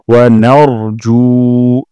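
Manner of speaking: "নারজু" with জু held one alif long; slow, stretch the জু; lengthened ju. Shown as a demonstration. Teacher showing the long জু. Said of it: "জুতে এক আলিফ টান দিব".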